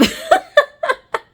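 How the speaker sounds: high burst of laughter; sudden high cackle; big laugh. Real, not acted. Laughing at Lydia's reaction.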